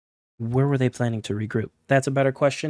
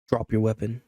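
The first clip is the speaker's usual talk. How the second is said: a lightly voiced flat, calm command; a hint of an eerie, even order; calm monotone. Jordan mind-controlling the sentry, lightly voiced calm monotone. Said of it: "calmly in a disturbing monotone voice".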